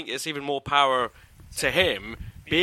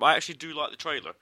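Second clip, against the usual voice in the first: ordinary delivery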